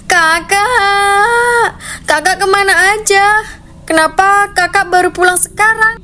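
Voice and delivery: put-on high, tearful girl's voice, sobbing out the questions; a younger sister weeping at her brother's return; Melisa crying. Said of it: "Sambil menangis".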